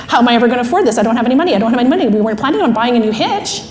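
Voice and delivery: flustered, run-on worrying; spinning. Acted. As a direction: protest in a rush, repeating yourself. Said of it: "this whole time, emotional brain's spinning".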